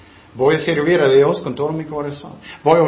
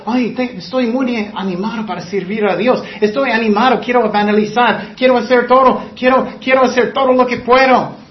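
higher, louder eager rush of zeal; quoting a shallow believer with mock fervor; mock gushing enthusiasm; 6 semitones higher, 3 dB louder than usual. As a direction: play the overexcited convert. Voicing an emotional new believer.

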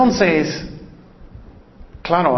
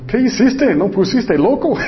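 pastor's scolding; 6 semitones higher than usual. Retold or imitated. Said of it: "él dijo".

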